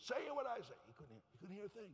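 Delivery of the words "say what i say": high, raised-voice command; pitched-up mock yell; retold screaming. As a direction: call it out high to deaf ears. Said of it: "screaming words".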